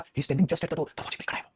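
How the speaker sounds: quietly, almost under the breath; hushed. Real, not acted. Teacher hushes while reading to the class, quiet. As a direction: drop to a near whisper. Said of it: "धीरे धीरे बोल".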